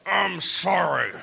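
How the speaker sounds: a slow, sulky, unwilling apology; grudging sorry. Pretend. Child forced to apologise to siblings, slow and sulky. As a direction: drag the apology out like a scolded child who doesn't mean it. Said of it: "Say it like you mean it".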